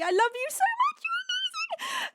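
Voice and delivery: very high squeal of love; high-pitched gushing thanks; squeaky gush. Real, not acted. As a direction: squeal it out adoringly.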